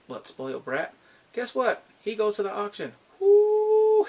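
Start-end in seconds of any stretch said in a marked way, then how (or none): 3.2-4.0 very high, loud squealed ooh; a thrilled, gleeful ooh; excited ooh. Real, not acted. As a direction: squeal with delight. Excited reaction to the spoiled brat.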